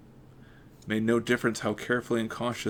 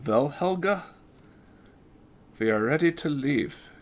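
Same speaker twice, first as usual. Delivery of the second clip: put-on retaken German accent, higher; 5 semitones higher, 3 dB louder than usual. Big Doc announces to Helga, German accent. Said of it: "That's new for me".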